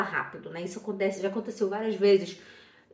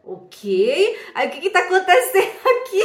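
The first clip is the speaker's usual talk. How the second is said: perdida, alto e agudo, rindo; confusão encenada; 7 semitones higher, 10 dB louder than usual. Retold or imitated. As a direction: pergunta exagerada de quem não entende nada, já caindo no riso. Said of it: "todo mundo ria, eu ficava assim". (confusa, aguda e forte, entre risos)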